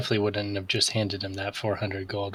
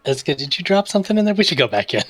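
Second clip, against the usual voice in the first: excited; 9 semitones higher, 8 dB louder, faster than usual. Acted. Player character calls out.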